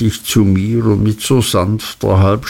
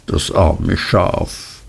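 moved to tears; 4 semitones lower, slower than usual. A narrator's reading. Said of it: "rannen meiner Tante Tränen der Rührung".

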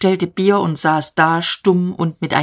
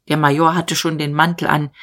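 plain reading voice

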